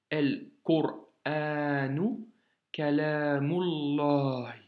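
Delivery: El Qur'anu Kalamullah, slow and linked; liaison. Shown as a demonstration. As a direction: read slowly, joining Kalamullah. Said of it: "Tu vois la liaison ici". (El Qur'anu Kalamullah, lentement, avec liaison)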